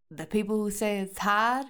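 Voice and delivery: put-on Boston accent, dropped r's. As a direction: say it like a Boston local. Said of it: "like, a Boston accent".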